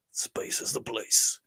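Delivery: slow, hushed whisper; whispered character voice. Acted. Host reads a viewer's list in character, whispered. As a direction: whisper it slowly like a secret. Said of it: "we gotta do a voice for the Chris Mewa".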